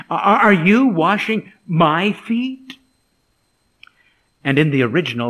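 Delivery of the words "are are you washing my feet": stammering, loud, high disbelief; surprised. Acted. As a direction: stumble in astonishment, slow rise to the end. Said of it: "You notice Peter's surprise".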